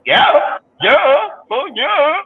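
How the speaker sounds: high, mocking yeah-yeah. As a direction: parrot them in a silly high voice. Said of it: "tell me, like".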